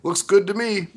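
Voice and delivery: high, breezy, careless approval; mock approval. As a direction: toss it off like a fan who likes everything. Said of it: "different people saying".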